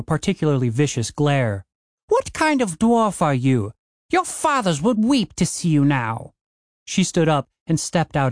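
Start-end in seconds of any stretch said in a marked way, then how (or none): 2.1-6.3 high, indignant outburst; outraged dwarf woman. Acted. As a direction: shame them with outrage. Jorn shaming Dave.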